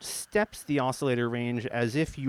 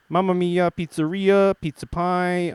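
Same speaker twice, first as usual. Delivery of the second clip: jolly Italian pizza chef; 5 semitones higher, 5 dB louder than usual. Pretend. Italian words after Italian terms.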